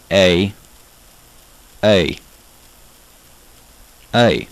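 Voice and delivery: "A" said like "hey" without the H; Southern A. Shown as a demonstration. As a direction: demonstrate "A" as a drawn-out Southern vowel. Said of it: "A is pronounced like hey".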